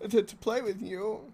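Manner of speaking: squeaky awkward nerd asking to play. Pretend. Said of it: "give us your nerd voice".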